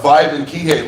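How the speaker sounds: usual speaking voice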